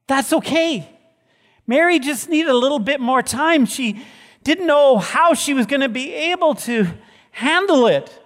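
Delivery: high, loud, near tears; pushing through tears, strained; voice breaking with emotion. Real, not acted. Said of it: "emotional climax".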